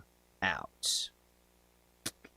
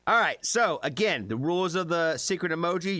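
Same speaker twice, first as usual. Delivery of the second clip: announcer voice; 5 semitones higher, 3 dB louder than usual. Acted. Host announcing the game rules.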